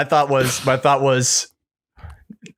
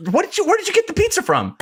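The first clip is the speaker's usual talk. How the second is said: imitating frantic Nick; faster than usual. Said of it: "He's like".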